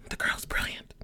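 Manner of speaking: breathy whispered aside; whispering. Real, not acted. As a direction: whisper it like a secret. Whispered repeat of praise.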